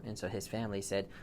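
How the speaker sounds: plain reading voice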